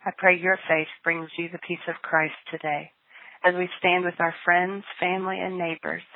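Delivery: ordinary talk, nothing marked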